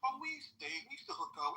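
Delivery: high, offhand mock girlfriend voice; casual girlfriend. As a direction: play the girlfriend shrugging it off. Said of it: "you're like".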